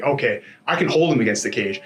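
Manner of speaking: usual speaking voice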